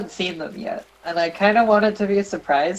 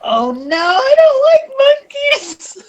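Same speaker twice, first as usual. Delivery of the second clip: mock wail; 8 dB louder, slower than usual. Wailing about monkey villager.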